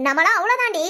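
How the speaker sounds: ordinary talk, nothing marked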